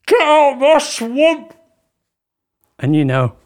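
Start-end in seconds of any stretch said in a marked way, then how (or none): 0.1-1.5 loud, high, drawn-out bad Scottish accent; badly faked Scottish ogre roar. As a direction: bellow it in a hammed-up Scottish accent. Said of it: "And it's in a Scottish accent".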